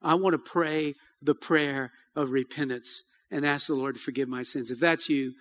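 soft, hushed invitation to repent; quiet altar call. Acted. Pastor inviting the congregation to repent, softly. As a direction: speak gently to bowed heads.

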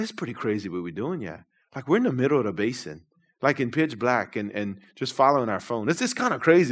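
soft, low-voiced caution; quiet retold warning in the dark; hushed warning. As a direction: lean in and hush it like a worried friend. Brother's hushed warning in dark boat.

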